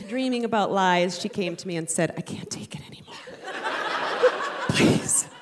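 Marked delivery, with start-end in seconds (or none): 2.2-5.3 fast, begging whisper; desperate whisper. Retold or imitated. Daughter begs her mother to stop lying, whispered. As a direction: plead in a hushed rush.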